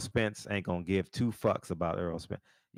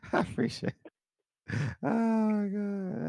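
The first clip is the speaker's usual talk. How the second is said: breathy laughter through the words, slow and high; can barely get it out for laughing; cracking up at the caller; 13 semitones higher, much slower than usual. Real, not acted.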